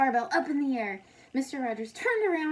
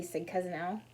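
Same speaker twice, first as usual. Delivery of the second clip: ordinary delivery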